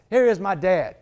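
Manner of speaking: ordinary talk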